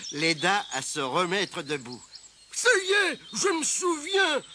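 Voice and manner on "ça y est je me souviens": high, sudden recollection; triumphant announcement. A narrator's reading. Mole has just remembered the clue, triumphant. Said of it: "annonça Trébuche".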